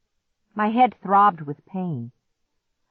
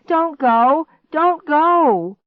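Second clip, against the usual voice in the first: high, loud plea building higher; desperate shout; 10 semitones higher, 9 dB louder, much slower than usual. A narrator's reading. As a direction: shout after someone leaving. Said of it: "I shouted".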